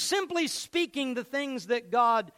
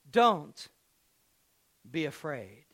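calm reassurance; 8 semitones lower than usual. Acted. Prophet calming his servant.

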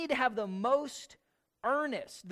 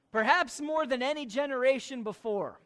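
ordinary delivery

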